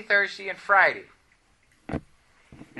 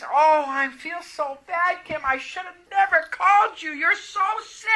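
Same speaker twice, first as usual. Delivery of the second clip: put-on girlfriend's voice; 9 semitones higher than usual. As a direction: mimic a worried friend on the phone. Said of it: "she says".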